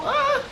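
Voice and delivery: short high-pitched "ah!"; a sudden playful high yelp; mock yelp. Cook voicing scattering food, mock yelp. Mimics the onion falling apart.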